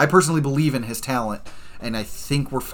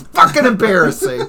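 laughing; 11 semitones higher, 9 dB louder than usual. Real, not acted. Laughing rant about loss.

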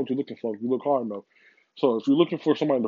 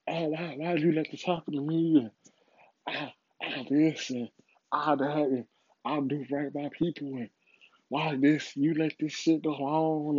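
mock whining to God, higher and softer; a hammed-up complaint to heaven; whiny plea; 3 semitones higher, 5 dB quieter than usual. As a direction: whine like a victim begging God why. Mocking people who blame God.

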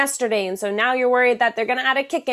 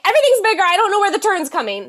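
raised, louder panicky gasp of a voice; mock alarm; 8 semitones higher, 6 dB louder, faster than usual. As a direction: act flustered, thrown into the car. Imagined panic in driver seat.

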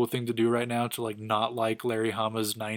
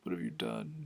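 much lower and softer, a shaken villain; mock worried Magneto; 13 semitones lower, 11 dB quieter than usual. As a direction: voice Magneto gasping in disbelief, low and hushed. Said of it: "'cause he's like".

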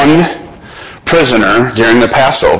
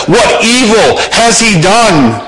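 much higher, slow, protesting question; Pilate pleading; 10 semitones higher, slower than usual. Retold or imitated. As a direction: stretch it out in disbelief. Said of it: "Pilate says".

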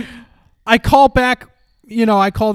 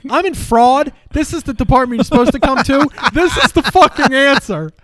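mock fraud agent; 6 semitones higher than usual.

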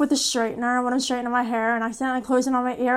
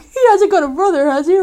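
louder, faster ditzy take; mock dumb voice; 5 semitones higher, 6 dB louder, faster than usual. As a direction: play it airheaded, quickly. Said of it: "Harry Blonde moments".

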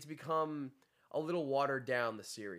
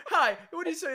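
mock friend; 8 dB louder than usual. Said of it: "he's like".

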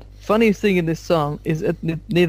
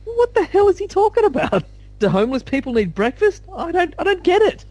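baffled disbelief; 14 semitones higher than usual. Retold or imitated. Mocks own baffled past self.

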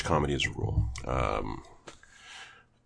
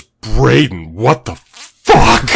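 exasperated yell; 2 semitones higher, 11 dB louder, much slower than usual. Retold or imitated. Sketch guy turning to Braden, a loud outraged yell. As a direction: demand an answer from Braden, outraged. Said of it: "and he goes".

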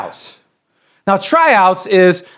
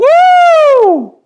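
high, loud joyful whoop; excited whoop; 6 dB louder than usual. Real, not acted. Preacher cheering the girl's breakthrough, high whoop. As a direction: let out a big celebratory woo.

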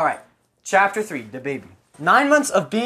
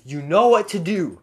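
usual speaking voice

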